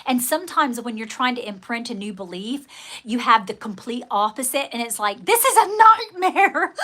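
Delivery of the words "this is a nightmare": high, slow exasperated wail; mock dismay. Herself mocking her own dismay, high and slow. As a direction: wail it like a bad dream come true.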